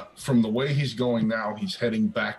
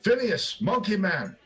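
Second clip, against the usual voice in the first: pitched-up mock holler; imitated call; 9 semitones higher than usual. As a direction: mimic someone calling into a room. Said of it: "popped her head in to say".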